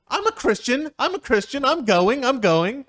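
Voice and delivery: high, loud protesting; insistent Jeffrey. Acted. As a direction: insist hard, voice climbing.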